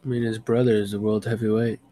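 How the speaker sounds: ordinary talk, nothing marked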